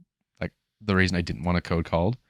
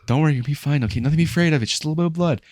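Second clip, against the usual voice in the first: high-pitched, louder soothing patter; mock reassurance; 9 semitones higher, 5 dB louder, faster than usual. First-aider fussing over a nosebleed, mock reassurance. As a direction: imitate someone over-reassuring a patient.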